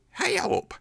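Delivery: very high squeaky cartoon hey up; Penelope Pitstop. Exaggerating the diphthong as a squeaky cartoon heroine. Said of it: "like Penelope Pitstop".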